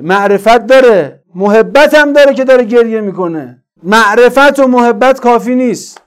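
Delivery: loud, high, impassioned sermon; full-force preaching. Real, not acted. Preacher denouncing feasting leaders of Kufa, full-force preaching. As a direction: denounce them at the top of a preaching voice.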